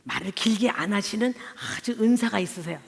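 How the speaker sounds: ordinary talk, nothing marked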